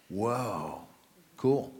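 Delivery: low, soft, deadpan "whoa, cool"; mock unimpressed. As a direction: play it down as if it were nothing special. Mock reaction to five converts.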